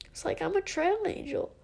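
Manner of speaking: the same words again, now close to tears; tearful. Real, not acted. Vlogger tells her viewers she's a trail angel, tearful. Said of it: "I'm crying".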